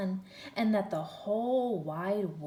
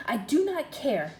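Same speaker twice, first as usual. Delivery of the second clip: loud, higher snap; snapped; 5 semitones higher, 8 dB louder than usual. A narrator's reading. Princess snapping at the frog, loud and sharp. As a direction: snap it back impatiently. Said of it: "the princess snapped".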